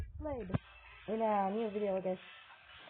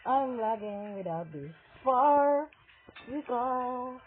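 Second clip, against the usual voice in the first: usual speaking voice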